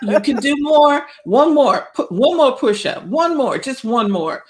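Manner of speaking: high, pushing coach encouragement; hype friend cheering. Pretend. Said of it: "the coach's voice is telling you".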